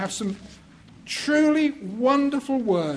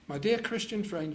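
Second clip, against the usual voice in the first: ordinary talk, nothing marked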